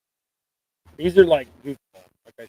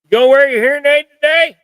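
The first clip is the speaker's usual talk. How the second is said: raised, high call to his hard-of-hearing wife; calling out loud; 11 semitones higher, 10 dB louder than usual. Real, not acted. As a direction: call across the room so she hears. She's gonna yell at me now.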